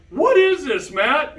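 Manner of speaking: startled question. Retold or imitated. Adam and John, staring at the portal, asking in alarm. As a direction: demand an answer in shock. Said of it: "Adam and John are like".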